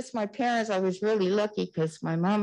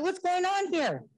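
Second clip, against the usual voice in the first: higher-pitched demanding question; retold alarmed demand; 8 semitones higher than usual. Vice principal coming over to a rumpus, alarmed demand. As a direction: imitate someone walking in on a rumpus. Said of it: "there was so much rumpus in the room".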